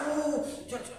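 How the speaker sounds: hammed-up panicked shooing; urgent hurry-up. Disciple hurrying the others after upsetting the guru, high and urgent.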